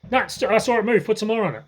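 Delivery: quick, pile the salt on, pitched high; urgent prodding. Retold or imitated. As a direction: grandfather mock-panicking to grandma. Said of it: "And this happens again".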